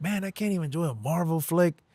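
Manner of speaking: high, exasperated grumble; a hint of a frustrated guy at the movies; frustrated quote. Complaining to himself during the sex scene, high and exasperated. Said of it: "I was like".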